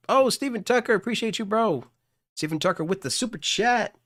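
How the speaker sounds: high, loud, eager thanks to a donor; light up and call out the name; excited shoutout. Real, not acted. Streamer excitedly thanking a super chat donor, high and loud.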